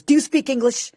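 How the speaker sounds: high, patronizing put-on voice; condescending supervisor. Airport supervisor questioning her, mock condescending. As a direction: talk down to her like a rude official. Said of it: "looks at me, goes".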